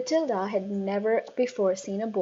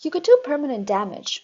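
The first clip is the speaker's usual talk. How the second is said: higher, worried protest; worried teacher; 5 semitones higher, 6 dB louder, faster than usual. A narrator's reading. Teacher protesting to the headmistress, higher. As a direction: protest anxiously. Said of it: "Miss Honey said".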